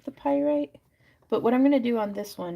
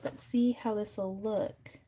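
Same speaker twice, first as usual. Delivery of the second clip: ordinary talk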